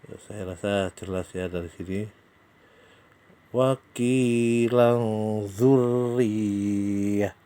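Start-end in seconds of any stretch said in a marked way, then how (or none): 3.5-7.3 "Wa qilan dzurriyyah" slow, tanwin hidden into the z with a hum; Quran recitation. Shown as a demonstration. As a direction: recite "Wa qilan" stretched, nasal held before "dzurriyyah". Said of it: "Tanwin ketemu zal". (bacakan "Wa qilan dzurriyyah" pelan, tanwin didengungkan)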